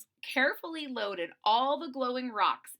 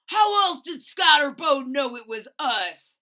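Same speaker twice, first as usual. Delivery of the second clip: loud, higher, accusing; angry demand; 5 semitones higher, 12 dB louder than usual. Acted. Tyrone demanding an answer from Boland, loud and angry. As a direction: demand the truth, jabbing. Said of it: "Tyrone demanded".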